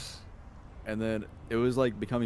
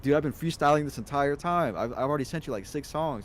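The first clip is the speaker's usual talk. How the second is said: indignant; 7 semitones higher, 4 dB louder than usual. Retold or imitated. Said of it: "he goes".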